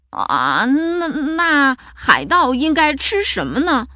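slow, stammered question climbing high; bewildered monster. A narrator's reading. Monster asking what pirates eat, bewildered and slow. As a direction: ask haltingly, at a loss. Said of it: "丑妖怪问".

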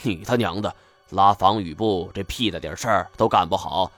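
usual speaking voice

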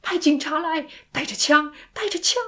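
high, loud, frantic demand; shouting. Acted. Sandy ignoring the operator's question, shouting for armed police. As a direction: shout over the operator, pitch climbing. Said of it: "山迪自顾自地大叫".

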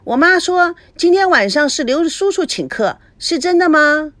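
put-on high, eager child asking; a cheeky little girl checking the news; bright child voice, pitched high. Narrator voices little Feifei.

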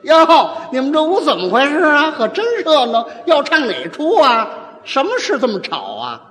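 a chatty neighbour woman bustling in; woman peacemaker. Acted. Neighbour woman bustles into quarreling couple's room, mock female voice. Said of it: "女的过去是这样说".

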